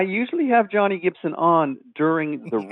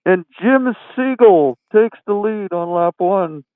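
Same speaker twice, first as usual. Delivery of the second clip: slow, muffled announcer voice, louder; talking into a pillow; 6 dB louder, slower than usual. Retold or imitated. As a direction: call the race as if speaking into a pillow. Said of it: "It sounds like he's talking into the pillow".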